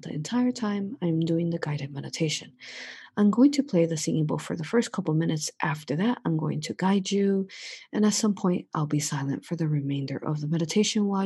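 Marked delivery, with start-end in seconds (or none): none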